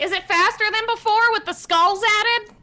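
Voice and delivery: high-pitched yelled question; yelling up. Acted. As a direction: yell it up to someone flying overhead. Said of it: "I yell, like".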